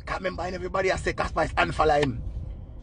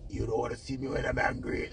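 much softer, quoted warning; mock hushed threat; 3 semitones lower, 10 dB quieter than usual. Vlogger quoting [name]'s line about her ex, softly. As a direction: tuck it down to a quiet threat. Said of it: "the Ras choke her say".

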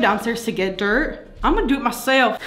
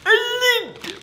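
mock high elite; 13 semitones higher than usual. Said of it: "the most elite".

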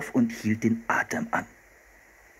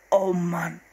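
exasperated thought; 7 semitones higher than usual. A narrator's reading. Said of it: "dachte Philipp".

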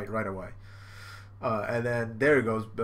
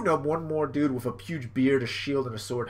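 ordinary talk

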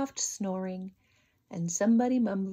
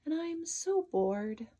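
higher, slow sighing whine; bored sigh; 7 semitones higher than usual. Acted. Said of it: "he sighed to himself".

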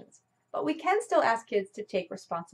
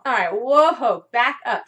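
louder, slow whoa that halts the story; emphatic slow interruption; stop short; 4 semitones higher, 10 dB louder, slower than usual. Real, not acted. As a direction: pull the listeners up sharply. Teacher halts her own example.

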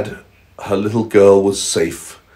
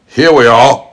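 lightly voiced wolf, higher and louder; a louder, higher wolf calling out; wolf's voice; 6 semitones higher, 7 dB louder than usual. Wolf's line acted in storytelling.

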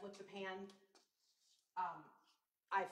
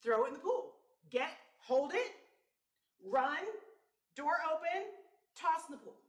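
urgent inner commands; 6 semitones higher, 9 dB louder than usual. Retold or imitated. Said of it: "That's what my brain said".

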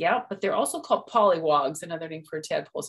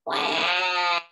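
mock baby wail; 3 dB louder than usual. Baby cries.

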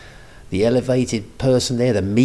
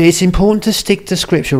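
high, loud imitation of a woman; put-on woman's voice; 9 semitones higher, 5 dB louder than usual. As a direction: quote the commenter in a raised mock female voice. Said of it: "It says here".

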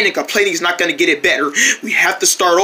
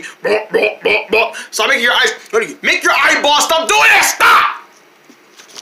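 angry yelling; 3 semitones higher, much slower than usual. Acted. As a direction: lose your temper and yell. Yelling at friend's eyes.

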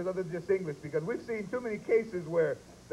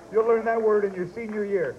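normal talking voice

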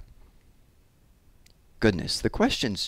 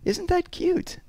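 very high, cooing exclamation; gushing exclamation; slower than usual. A narrator's reading. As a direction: exclaim way up in pitch. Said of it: "exclaimed Miss Pearl".

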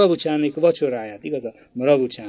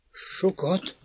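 a faltering, much softer "Sokat?" rising; stammering, pale; 5 semitones higher, 5 dB quieter than usual. A narrator's reading. Girl echoing her brother's word, stammering and going pale. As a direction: stammer "Sokat?" as the blood drains away. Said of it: "dadogta elhalványodva".

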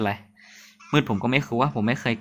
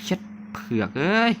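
sudden high-pitched swear; startled curse; 7 semitones higher than usual. Real, not acted. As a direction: jump at the shock and blurt it out. Sudden scare in dark game.

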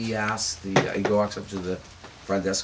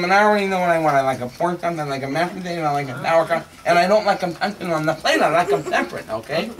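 higher, louder, testy insistence; irritated; 6 semitones higher, 5 dB louder than usual. Acted. Guest repeating his order to the maitre d', irritated. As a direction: lay down the order, getting annoyed.

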